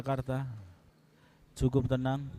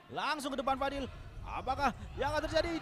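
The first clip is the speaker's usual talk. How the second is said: excited; 9 semitones higher, 3 dB quieter, faster than usual. Real, not acted. Rising excitement at attack.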